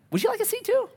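a cheerful man offering a chair; polite stranger. Retold or imitated. Stranger kindly offers a seat.

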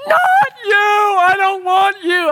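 put-on high, loud crying toddler shout; screaming toddler. Toddler son refusing his dad, crying shout. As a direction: wail like a furious three-year-old. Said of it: "Myron's like".

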